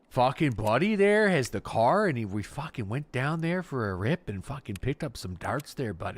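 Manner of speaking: mock prairie Canadian buddy recounting a night out; prairie Canadian accent. Imitating a prairie local bragging about a rip, in a mild Canadian accent. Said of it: "like, the prairies".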